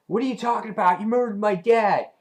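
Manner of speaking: mock-angry comic-book retort; acted indignant outburst. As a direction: play a kid hurling an accusation. Acting out the comic's quarrel.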